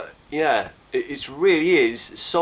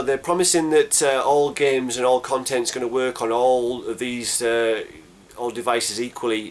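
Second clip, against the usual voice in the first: ordinary talk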